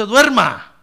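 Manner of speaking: loud preaching shout; shouted wake-up call. Real, not acted. Predicador grita a la congregación. (gritando con fuerza)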